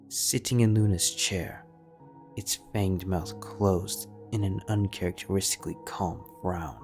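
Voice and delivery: ordinary delivery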